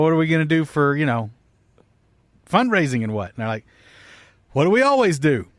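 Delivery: usual speaking voice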